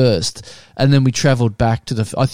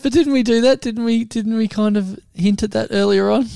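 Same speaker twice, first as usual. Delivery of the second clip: much higher, pleading disbelief; incredulous; 8 semitones higher, 3 dB quieter than usual. Real, not acted. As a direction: protest in high disbelief. Insists they discussed it.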